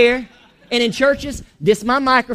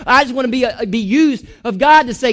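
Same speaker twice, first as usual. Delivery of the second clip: normal talking voice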